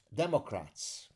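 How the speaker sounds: normal talking voice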